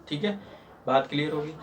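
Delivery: ordinary delivery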